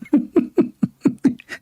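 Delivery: high delighted cackle; big high laugh. Real, not acted. Laughing at being called anti-Islamic.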